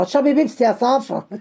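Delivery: ordinary talk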